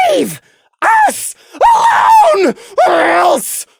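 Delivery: very high, loud, tearful threat; slow, shrieking warning; very high, sobbing shouted threat; crying threat. Acted. Prey's furious threat.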